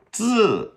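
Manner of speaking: "自" loud, high buzzed dz, sharply falling; zi sound demo. Shown as a demonstration. Teacher repeating the hard sound, high buzzed "自". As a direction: say "自" as D running straight into Z, pitched high. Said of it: "go straight to the Z".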